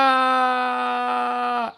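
sudden high pained cry; anguished cry. Acted. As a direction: cry out in pain. Creature cries out in story.

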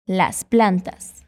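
"Las plantas" stretched out slowly, ending with a fall; slow model phrase. Shown as a demonstration. Teacher modelling "Las plantas" slowly, falling at the end. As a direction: spell out "Las plantas" slowly for the learner to copy. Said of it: "Fala comigo".

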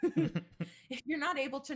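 laughing through the words; giggling while saying it again. Real, not acted. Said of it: "repeats it laughing".

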